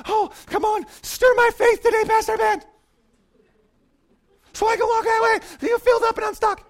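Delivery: high, far-off whiny plea to the pastor; mock pleading churchgoer. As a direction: ham up a needy believer begging to be fixed. Mocking churchgoer begging pastor.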